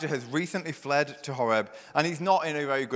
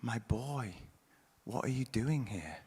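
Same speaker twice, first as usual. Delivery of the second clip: gentle God; 7 dB quieter than usual. Acted. God gently asking Elijah, soft and tender. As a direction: ask tenderly, like a father bending down. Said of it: "Gently, he deals with him".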